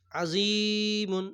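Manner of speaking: slow recited আজিমুন with long vowels; chanted আজিমুন, held long; drawn-out recitation. Shown as a demonstration. Quran reading drawn out.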